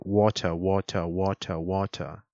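"water" repeated with a hard T and no final R; British accent. Shown as a demonstration. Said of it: "In the British accent".

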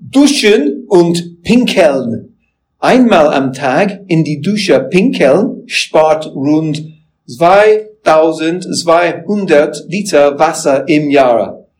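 slow, careful reading of German words. Shown as a demonstration. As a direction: read the German slowly, apologizing in advance. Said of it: "so here is my best German".

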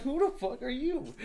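high, amused disbelief; mock incredulous. Friend retelling his amused disbelief, high. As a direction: ask it like you can't believe him. Retold reaction.

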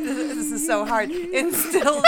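high-pitched talk through laughter; tickled giggling. Real, not acted. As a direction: complain helplessly while being tickled. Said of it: "she's, like, tickling my neck".

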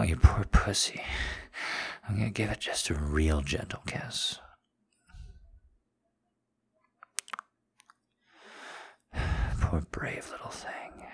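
tender afterglow lover, mostly whispered; breathless, giggly lover after sex; intimate whisper. Acted. Aftercare role in audio piece.

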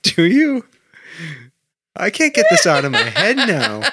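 can't stop laughing while saying it. Real, not acted. Laughing fit while repeating line.

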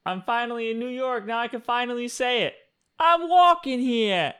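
mock New York holler, pitched high; excited tourist imitation. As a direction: imitate a giddy dude announcing himself to New York. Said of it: "some dude on TikTok, and he's in New York. He's like".

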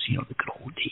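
hushed wistful whisper. Acted. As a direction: breathe it like a fond memory. Repeats phrase in whisper.

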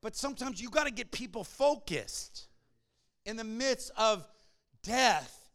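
forceful preaching. Acted. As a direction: drive the message home to the congregation.

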